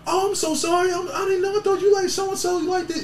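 squeaky high voice for the sorry woman; high mock apology. Retelling the woman apologizing to George, high and flustered.

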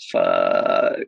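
very low, drawn-out curse under the breath; dread-filled curse. Retold or imitated. Son recalling his mother's threat to intruders, low dread-filled curse. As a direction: let the word sink right to the bottom of the voice.

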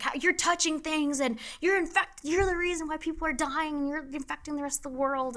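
high, accusing mock yell; angry stranger yelling. Man at gas pump accusing a nurse, high mock yell. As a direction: rant at her like a furious stranger. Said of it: "yelling at me".

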